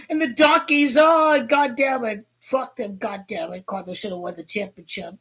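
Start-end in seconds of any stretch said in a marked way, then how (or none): none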